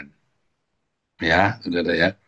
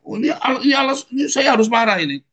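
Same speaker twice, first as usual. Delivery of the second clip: much higher excited excuse; mock hothead. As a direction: stumble into justifying the anger. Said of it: "saya kan gitu".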